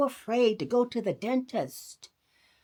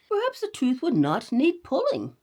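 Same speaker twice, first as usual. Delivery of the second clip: mother's voice; 6 semitones higher, 4 dB louder than usual. Acted. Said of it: "said Mother".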